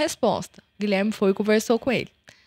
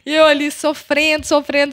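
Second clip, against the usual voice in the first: high, loud, hurried voice close to crying; near tears; 7 semitones higher, 4 dB louder than usual. Real, not acted. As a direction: pour it out while fighting tears. Mother reliving pain, near tears.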